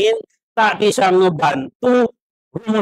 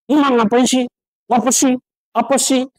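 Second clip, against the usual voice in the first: mock annoyed mother; 7 semitones higher than usual. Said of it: "Marah-marah aja".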